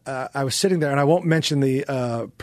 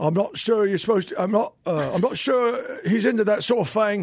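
higher Cockney palace guard line; Cockney guard accent; 5 semitones higher than usual. Retold or imitated. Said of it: "I had a Cockney accent".

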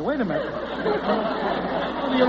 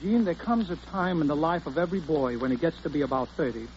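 confidential; 4 semitones lower, 6 dB quieter than usual. Acted. Confidential man-to-man talk.

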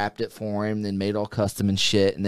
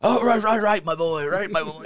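a hint of the doctor's German accent, higher; character voice; 9 semitones higher than usual. [name] caught staying in character, light German doctor accent. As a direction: slip back into the mad doctor. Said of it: "he's still talking".

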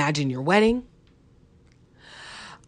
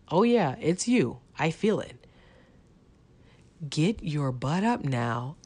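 ordinary talk

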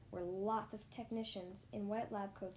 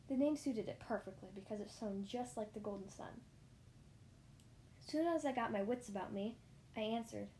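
ordinary delivery, nothing marked

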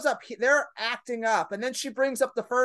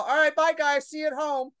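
put-on higher, chirpy goodbye to the kicked-out kids; sing-song mock farewell; 6 semitones higher than usual. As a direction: wave them off sweetly.

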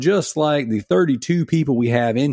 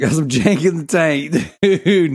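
laughing; 4 semitones higher, 4 dB louder than usual. Retold or imitated. Quoting his own joke.

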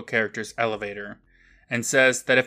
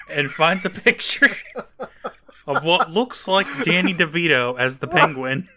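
raised voice breaking into real laughter; laughing while talking; 4 semitones higher, 4 dB louder than usual. Real, not acted. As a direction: try to narrate through a laughing fit. Cracking up while recapping.

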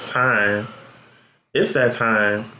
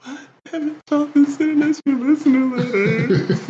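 mock crying; 12 semitones higher than usual. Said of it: "I'm tired of crying".

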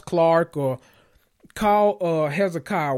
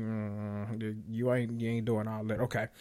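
quiet aside; 5 semitones lower, 10 dB quieter than usual. Real, not acted. Drops voice, sly aside.

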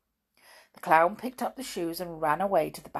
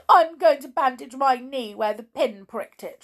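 high, loud hurt announcement; tearful declaration; 7 semitones higher, 8 dB louder than usual. Acted. Amelia Jane, hurt, announcing she'll bandage her knee. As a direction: say it sniffly and hurt.

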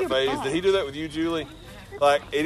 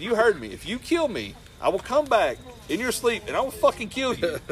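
mock threat; 10 semitones higher than usual. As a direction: threaten him half in jest. Retold threat to partner.

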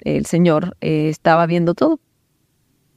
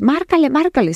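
high, insistent ordering; imitated pushy aggressor; aggressive; 10 semitones higher than usual. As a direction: press them to dial, pitched way up. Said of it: "muy, muy agresiva".

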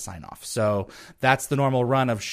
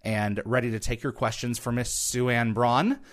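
ordinary talk, nothing marked

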